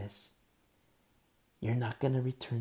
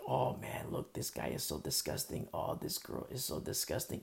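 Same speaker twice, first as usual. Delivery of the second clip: hushed sneering gossip, much lower; whispered mock gossip; 10 semitones lower, 8 dB quieter than usual. Preacher mocking churchgoers on high horses, hushed sneer. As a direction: whisper it like a self-righteous snob. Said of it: "preoccupied on our high horses".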